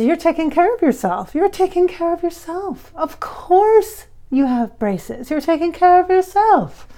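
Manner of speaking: high, loud, slow channeled voice; voicing Freddie. Freddie reassures her about braces, high and loud. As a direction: gush it out like a spirit guide reassuring her. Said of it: "And he says".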